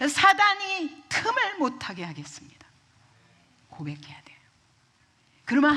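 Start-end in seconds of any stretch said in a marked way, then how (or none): 0.1-2.7 high, forceful prayer of resolve; resolute vow. Acted. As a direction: declare it loudly, as a vow to God. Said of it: "그리고 결심해야 됩니다". (결단하며 높고 힘차게 기도하듯)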